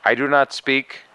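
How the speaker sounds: usual speaking voice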